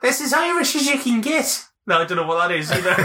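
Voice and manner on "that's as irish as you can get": high-pitched mock Irish; badly faked Irish lilt; mock Irish accent. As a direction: put on a silly, high Irish voice. Jokey attempt at an Irish accent.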